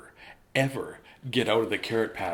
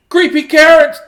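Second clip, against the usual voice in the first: much higher, loud panicked scream; shout; 22 dB louder than usual. Acted. Frightened Jasper screaming for his dad. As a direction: yell it in terror. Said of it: "he shouted".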